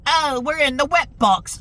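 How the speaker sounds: high mock voice sneering at the dialogue; mocking cheesy line. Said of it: "the cheesy, like, fucking".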